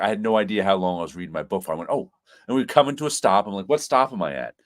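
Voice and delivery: ordinary talk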